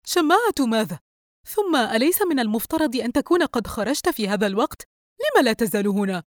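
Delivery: indignant. A narrator's reading. Wife challenging husband on why he is still here, indignant. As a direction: fire the questions at him, affronted.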